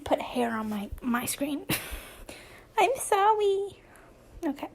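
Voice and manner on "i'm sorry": put-on high, childish little sorry; playful baby-talk apology. Apologizing for hair on the screen, high baby talk.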